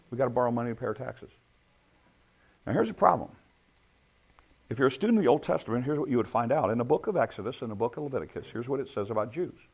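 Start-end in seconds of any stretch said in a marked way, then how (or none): none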